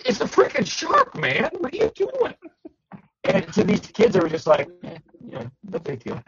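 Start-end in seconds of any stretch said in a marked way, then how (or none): 0.1-2.3 replaying a startled, high-pitched protest; shocked outburst. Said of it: "And I was shocked". 4.8-6.2 much softer, offhand imitation of unfazed kids; mock indifference, quietly dismissive; nonchalant shrug. Acting out kids' unfazed reply.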